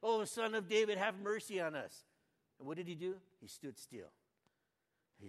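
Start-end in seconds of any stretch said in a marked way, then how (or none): 0.0-2.0 pleading cry, pitched up and louder; preacher crying the plea aloud. Retold or imitated. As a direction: call out to the Son of David with raised voice. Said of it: "cried out again".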